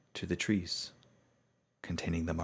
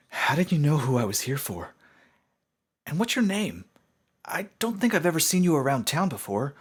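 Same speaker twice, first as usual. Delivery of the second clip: much higher, much louder pleading; high, loud, eager explanation; earnest young pony; 10 semitones higher, 9 dB louder than usual. Acted. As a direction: plead your case urgently. Young pony presses stranger.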